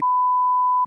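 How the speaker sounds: sudden high laugh breaking into the line; real laugh while telling the story; burst of laughter. Real, not acted. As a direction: crack up mid-sentence. Laughing mid-quote at the story.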